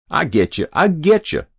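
high, loud, cocky cut-in; nasty interruption. A narrator's reading. Lout cutting in on the officials, nastily. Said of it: "he cut in nastily".

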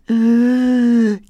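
loud, high gasp bursting out; big gasp for air. Acted. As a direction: gulp air like you've just surfaced. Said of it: "gasping for air".

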